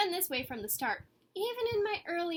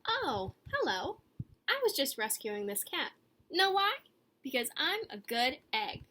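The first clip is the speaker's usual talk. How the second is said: bright, slow storybook voice; cosy story time reading; reading to children; 3 dB louder than usual. A narrator's reading. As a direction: read it to little ones, sweetly. Leads a meditation.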